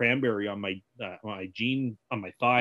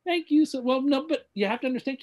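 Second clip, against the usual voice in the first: high-pitched actor impression; hammy, high put-on thanks; Christopher Walken; 12 semitones higher than usual. Shown as a demonstration. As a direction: answer in an odd celebrity voice. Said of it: "a conversation of Christopher Walken".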